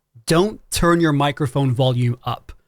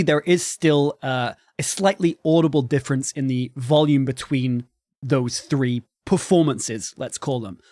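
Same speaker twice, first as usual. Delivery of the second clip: ordinary talk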